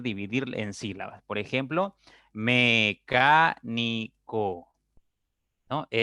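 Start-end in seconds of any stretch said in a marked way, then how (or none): 2.3-3.6 slow, syllable by syllable, louder; mecánico said slowly in four separate beats; split into syllables. Shown as a demonstration. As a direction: tap out each syllable: me-cá-ni-co. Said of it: "golpes de voz".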